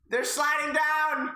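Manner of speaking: high, slow shout with a laugh; panicked shout. Acted. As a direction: yell it, breaking into laughter.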